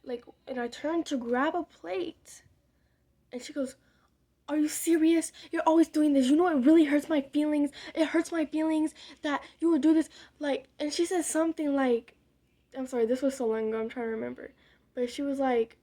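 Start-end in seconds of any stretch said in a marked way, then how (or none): 4.5-10.1 imitating an exasperated mom, louder; mock hurt outburst. Retelling mom's reaction at the dinner plate, loud exasperated imitation. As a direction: act out a mother's loud, wounded scolding.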